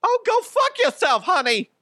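very high, loud sarcastic wife; high loud sarcastic brush-off; mock shrill wife. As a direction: screech it like a fed-up wife. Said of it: "she'd be like".

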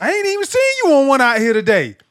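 fast, loud, high Southern teasing; Southern twang. Retold or imitated. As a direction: needle him quickly in a Southern twang. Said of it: "Renard had this Southern twang".

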